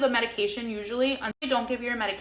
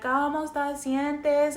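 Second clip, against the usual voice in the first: slow, higher-pitched Spanish, imitating a nurse; Cuban nurse; 6 semitones higher, much slower than usual. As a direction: draw it out like a nurse rousing a patient. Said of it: "Cuban or whatever".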